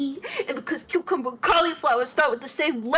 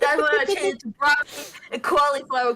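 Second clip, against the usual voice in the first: put-on a light, higher New York accent; 3 semitones higher, 5 dB louder than usual. Retelling her vegetable order in a New York accent. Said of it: "I don't know why I'm doing this accent".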